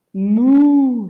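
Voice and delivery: loud, drawn-out moo; cow moo. A narrator's reading. Imitates cow.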